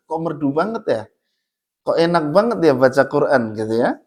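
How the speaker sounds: normal talking voice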